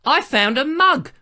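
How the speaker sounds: showman's big reveal; triumphant reveal. Acted. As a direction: announce it like a grand prize.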